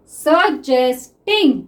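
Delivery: stretched very slowly while writing; drawn-out word. Shown as a demonstration. Teacher writing shorthand for students, word stretched slowly. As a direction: draw the word out as you write it. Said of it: "teacher sounds the word out while writing".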